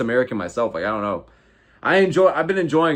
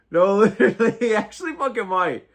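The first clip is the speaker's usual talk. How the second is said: laughing; 8 semitones higher than usual. Real, not acted. Still laughing at the roast.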